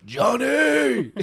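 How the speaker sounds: loud angry shout of a name through a laugh; mock furious dad yell, laughing. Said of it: "fury in his voice".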